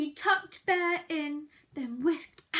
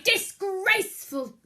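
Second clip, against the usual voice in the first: loud barked disgraceful; 4 semitones higher, 7 dB louder than usual. Acted. Bear scolding the singing Mouse, barked. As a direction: bark it in outrage. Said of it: "barked Bear".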